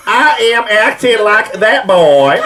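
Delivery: high, slow put-on Southern accent, laughing; overdone Southern drawl breaking into a laugh; Anna Paquin accent. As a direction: ham up a Southern belle lilt, cracking up. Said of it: "now I get to do the Anna Paquin accent".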